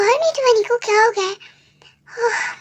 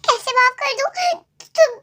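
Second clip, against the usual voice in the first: usual speaking voice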